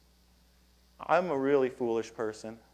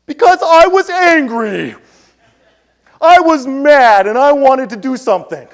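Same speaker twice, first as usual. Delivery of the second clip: clownish honesty; 10 semitones higher, 15 dB louder than usual. Retold or imitated. Man confessing his anger to the stranger, loud and clowning. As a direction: blurt out the truth like a clown. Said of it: "the clown took over".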